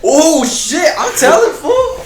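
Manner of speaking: high, loud schoolkid tattle; taunting threat to tell. Retold or imitated. Kid threatening to tell on him.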